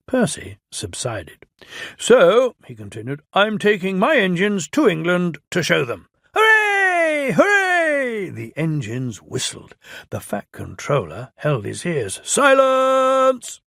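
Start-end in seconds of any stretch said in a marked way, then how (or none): 2.0-2.6 raised, pompous official voice; Fat Controller voice. Acted. 3.3-6.1 raised, pompous official voice; Fat Controller voice. Acted. 6.3-8.3 high excited cheer. Acted. Engines cheer. 12.2-13.6 higher, louder bellow for silence. Acted. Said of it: "he bellowed".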